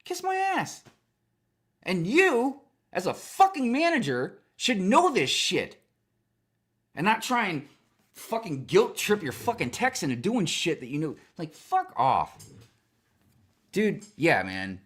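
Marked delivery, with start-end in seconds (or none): 0.1-0.8 high, loud indignant refusal; a squeaky, loud "no way" to the manager; retold outburst. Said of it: "I'm like". 1.8-12.4 loud, slow, heated tirade; high and loud, dragging each word; angry rant. Real, not acted. Ranting at the manager.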